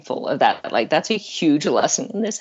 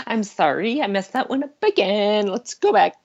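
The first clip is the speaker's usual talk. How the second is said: sheepish apology; 6 semitones higher than usual. Retold or imitated. Parent apologising to daughter, laughing.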